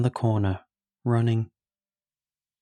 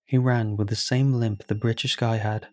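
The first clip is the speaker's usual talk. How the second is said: usual speaking voice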